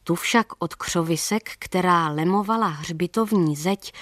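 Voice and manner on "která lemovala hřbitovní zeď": ordinary delivery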